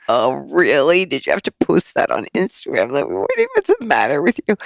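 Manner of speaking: mock exasperated teen-style scolding; eye-rolling daughter. Daughter complaining about mom's Instagram post, exasperated. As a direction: act appalled at mom, quickening at the end. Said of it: "a lot of eye rolling".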